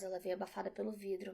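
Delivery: ordinary delivery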